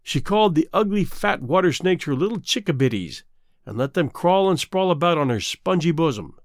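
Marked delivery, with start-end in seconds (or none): none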